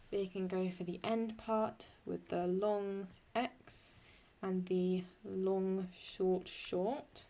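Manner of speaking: ordinary delivery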